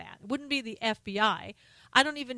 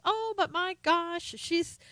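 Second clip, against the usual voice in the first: high, drawn-out mock amazement; mock gasp of awe; 9 semitones higher, slower than usual. Host mocking a politician getting a pass, sarcastic. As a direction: gush it slowly, in pretend wonder.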